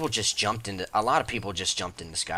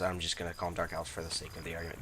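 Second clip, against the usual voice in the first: fast, softer throwaway remark; quick aside; 3 semitones lower, 8 dB quieter, faster than usual. Real, not acted. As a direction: rush the aside under your breath.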